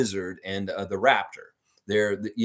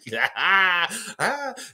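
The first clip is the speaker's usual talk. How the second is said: laughing; 8 semitones higher than usual. Real, not acted. Laughing at own story.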